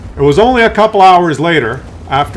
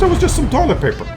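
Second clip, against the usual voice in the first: plumber's offhand voice; 11 semitones higher, faster than usual. Retold or imitated. Plumber voiced in retold story.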